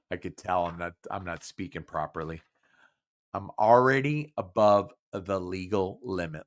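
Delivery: usual speaking voice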